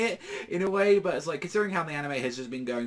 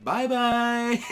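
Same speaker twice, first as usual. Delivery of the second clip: high, sing-song put-on voice; carefree goodbye; 11 semitones higher, 7 dB louder than usual. Sally carelessly waves off her allies in a high sing-song voice. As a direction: toss off a breezy farewell. Sally leaves, doesn't care.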